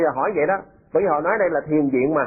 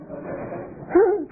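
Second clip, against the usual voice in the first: high laugh; 14 semitones higher than usual. Real, not acted. Laugh after joke.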